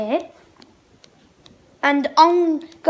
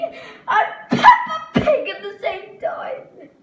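laughing; 7 semitones higher, slower than usual. Real, not acted. Laughs at absurd dare.

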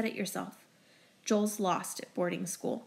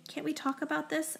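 high, soft plea; pleading; 8 semitones higher, 4 dB quieter than usual. A narrator's reading. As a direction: beg her gently to listen. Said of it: "I plead".

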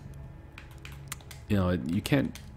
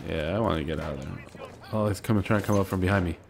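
ordinary talk, nothing marked

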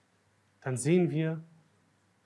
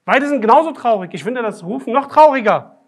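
much higher and louder, insistent; passionate emphasis; 7 semitones higher, 13 dB louder than usual. Real, not acted. Preacher arguing against the sheikh's story, loud and passionate. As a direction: press the point home with fervour.

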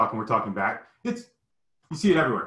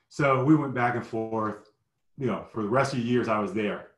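ordinary talk, nothing marked

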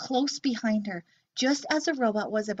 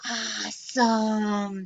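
awestruck; much slower than usual. Acted. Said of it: "said Gork".

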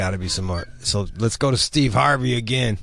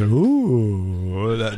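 normal talking voice

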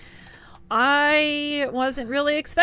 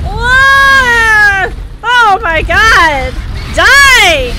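loud, high, drawn-out cries; frantic combat shouting; 7 semitones higher, 9 dB louder, much slower than usual. Real, not acted. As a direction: yell at the boss mid-fight. Fighting the boss.

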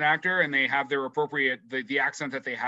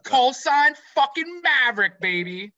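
higher, louder and slower, like a trailer voice; hyped announcement; 8 semitones higher, 4 dB louder, slower than usual. Real, not acted. Host hypes Maverick to co-host, slow and loud. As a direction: belt out the call sign like a hype man.

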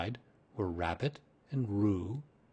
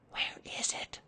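soft, voiceless stage whisper; 7 dB quieter than usual. A narrator's reading. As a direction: whisper it urgently under your breath. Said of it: "and whispered".

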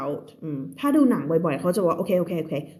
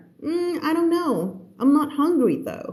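acted unsure reply; 8 semitones higher, much slower than usual. Shown as a demonstration. Teacher acts out unsure reply.

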